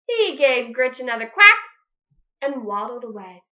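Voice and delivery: usual speaking voice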